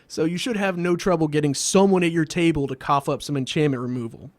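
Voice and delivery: ordinary talk, nothing marked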